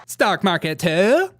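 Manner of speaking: slow, loud, mocking Southern twang rising at the end; high parody of a put-on Southern accent; A O C's Southern accent. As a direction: swing the last word up in a fake Southern accent. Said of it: "What is this accent?".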